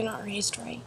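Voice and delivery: hushed, breathy disbelief; whispered aside. Real, not acted. Cohost judging rude kids, whispered disapproval. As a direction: drop to a whisper, scandalised.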